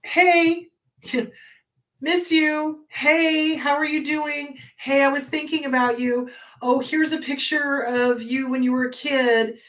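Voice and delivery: lightly voiced coaxing parent; mock sweet texts. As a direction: imitate a parent baiting you back. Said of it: "send you".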